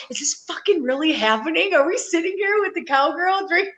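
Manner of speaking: retold incredulous question, pitched high; amazed disbelief. Girlfriend marvels at drinking with the cowgirl, amazed disbelief. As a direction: mimic a friend marveling, voice lifted high.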